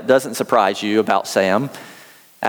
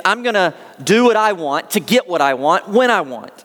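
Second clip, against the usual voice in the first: raised, fervent sermon delivery; preaching; 3 semitones higher than usual. Acted. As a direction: preach it with rising conviction. Preaches on the psalm.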